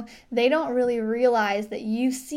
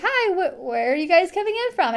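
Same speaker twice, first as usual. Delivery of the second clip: friendly greeting; 6 semitones higher, 3 dB louder than usual. Shown as a demonstration. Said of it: "just be like".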